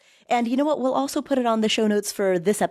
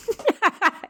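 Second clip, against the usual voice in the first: high laugh; 12 semitones higher than usual. Real, not acted. Laughing at own "Wednesday-ish.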